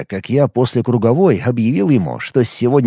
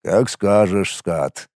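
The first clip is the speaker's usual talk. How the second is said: low, soft, indifferent concession; shrugging; 3 semitones lower, 4 dB quieter, much slower than usual. A narrator's reading. Warrior lets the narrator's outburst pass, shrugging. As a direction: give in with a quiet verbal shrug, dropping low. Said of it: "пожал он плечами".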